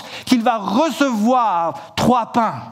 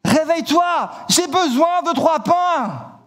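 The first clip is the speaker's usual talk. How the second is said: calling up high at a shuttered window; hammed-up shout to wake a sleeper; raised, calling voice, partly held back; mock shout; 6 semitones higher than usual. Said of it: "son ami qui crie".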